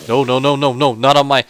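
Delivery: loud, rising no no no; loud protest. Real, not acted. Gamer yelling at a mob on his solar panel, loud protest.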